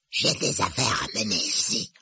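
husky disguised rasp; sinister, rough-voiced pretence; hoarse voice. A narrator's reading. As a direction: state your business in a hoarse ghostly voice. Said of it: "dit Harry de sa voix rauque".

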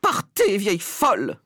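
very high, loud first word; high-pitched furious dismissal; outraged cry. Acted. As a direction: cry it out, piercing then slightly easing. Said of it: "cria madame Jenkins".

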